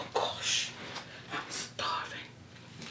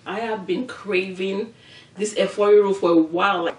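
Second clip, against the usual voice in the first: ordinary delivery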